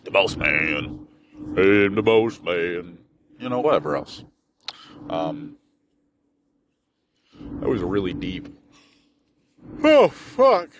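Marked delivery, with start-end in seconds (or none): 0.0-3.0 slow, drawn-out boss man voice; slow lordly boss man boast; swaggering boss man. Pretend. 9.8-10.7 sudden high loud oh fuck; high, loud startled swear; startled curse. Real, not acted. Sudden exclamation.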